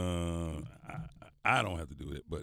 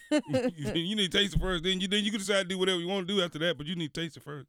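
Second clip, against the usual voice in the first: raised, high, firm and scolding; insistent scolding; 10 semitones higher, 4 dB louder than usual. Real, not acted. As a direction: lay down the law, loudly. Insisting host scolds guest.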